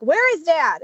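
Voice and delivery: high, loud, impatient question; exasperated demand. Retold or imitated. Impatient third call, retold.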